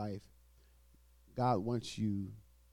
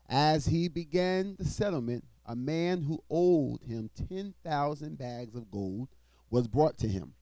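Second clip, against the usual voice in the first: loud, raised preaching read; scripture reading; 3 semitones higher, 4 dB louder than usual. Acted. Preacher reading Matthew aloud to the congregation, loud and proclaiming.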